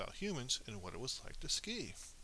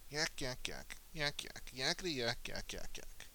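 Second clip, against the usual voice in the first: mock human chatter; 2 semitones higher than usual. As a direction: gabble it like noisy foreign chatter. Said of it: "It would just have sounded like".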